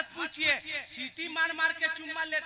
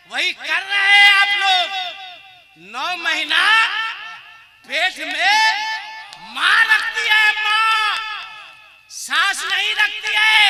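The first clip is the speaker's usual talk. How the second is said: loud, slow, higher preacher's cry; fiery preaching; 5 semitones higher, 8 dB louder, slower than usual. Acted. Cleric urging the crowd to honour mothers, full-force preaching. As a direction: hammer home the mother's sacrifice.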